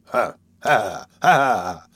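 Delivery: slow, spaced knowing "ha"; acted, meaningful little laugh; significant. A narrator's reading. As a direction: load each "ha" with meaning. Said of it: "said Monks significantly".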